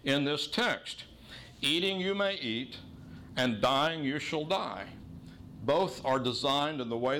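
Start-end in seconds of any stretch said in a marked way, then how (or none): 1.6-2.8 "Eating you may eat" set apart, pitched high; emphatic phrasing. Shown as a demonstration. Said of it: "two things are emphasized". 3.6-4.9 "dying you shall die" slow and raised in pitch; emphatic phrasing. Shown as a demonstration. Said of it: "two things are emphasized".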